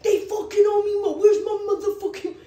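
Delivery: high, loud tough-guy rant; loud mock gangster phone voice. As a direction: play the hard gangster demanding his cash. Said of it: "such a gangster on the phone".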